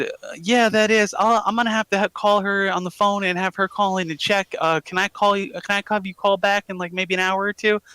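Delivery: high Southern mom on the phone; fake Southern woman. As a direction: put on a Southern mother's voice. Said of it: "easier to fake a Southern woman's voice".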